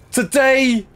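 high, drawn-out mock American drawl; bad American accent. Shown as a demonstration. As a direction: ham up a Southern American accent, phrase by phrase. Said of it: "I hate doing American accents".

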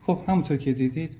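ordinary delivery

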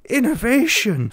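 loud, high burst of excitement; thrilled, high-pitched outburst; excited. Real, not acted. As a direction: gush with delight, drawing out the key words. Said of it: "Sorry, I got excited there".

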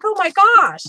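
high, excited oh my gosh; surprised gasp. Retold or imitated. Crafter seeing the huge box from a viewer, surprised. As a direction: react like the box is enormous. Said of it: "I'm like".

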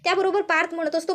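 ordinary delivery, nothing marked

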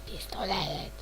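whispered single word. Real, not acted. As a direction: breathe the word like a secret. Finds item, whispers.

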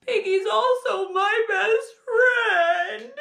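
high, slow, acted wail; distraught lament. Distraught Gerald laments to the doctor.

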